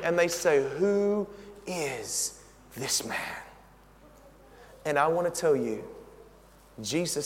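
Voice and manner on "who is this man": awed hush. Acted. Awed disciples in great fear.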